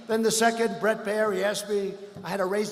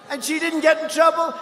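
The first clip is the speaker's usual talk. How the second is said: normal talking voice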